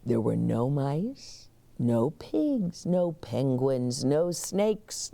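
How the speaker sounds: ordinary delivery, nothing marked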